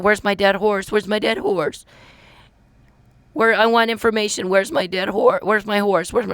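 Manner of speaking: high, insistent mock whine; mocking imitation. Ridiculing his endless asking about a lost horse, high mocking imitation.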